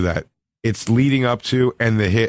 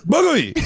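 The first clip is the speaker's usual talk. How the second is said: imitated pained yelp. Comic imitating the hit man's yelp, high squeal. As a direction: blurt Boogly like a man winded by a glass. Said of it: "the noise he makes".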